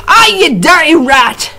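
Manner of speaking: high, loud insult; shout. A narrator's reading. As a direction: spit it at the rat. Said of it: "shouted the prisoner".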